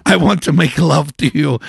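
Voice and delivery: hammed-up amorous Brazilian accent; imitated Brazilian accent. Retelling a Brazilian man's proposition, imitated accent. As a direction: play the Brazilian lover with an uncertain accent. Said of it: "he said it in this beautiful accent".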